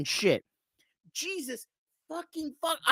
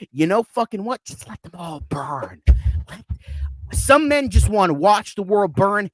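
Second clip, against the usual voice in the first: ordinary delivery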